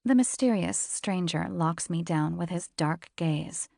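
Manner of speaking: usual speaking voice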